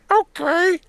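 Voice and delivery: squeaky, very high cartoon voice; silly high voice. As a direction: pitch way up into a goofy squeak. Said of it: "Let me do voices".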